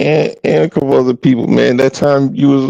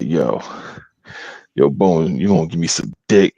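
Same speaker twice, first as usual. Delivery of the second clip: low, softer imitation of a come-on; Terry Crews; 6 semitones lower, 5 dB quieter than usual. Imitating an aggressive woman propositioning Bone, low mock tough-guy voice. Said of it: "straight up acting like, like freaking Terry Crews".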